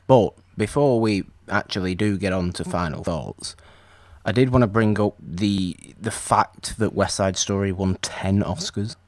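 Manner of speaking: usual speaking voice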